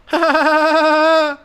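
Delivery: high loud burst of real laughter. Real, not acted. Laughing hard while telling a friend the story.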